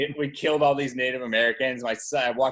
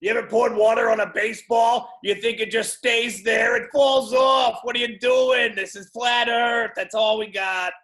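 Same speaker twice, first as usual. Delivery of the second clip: flat earther rant; 7 semitones higher, 5 dB louder than usual. Pretend. Pretend flat earther yelling at a doubter, loud mock outrage.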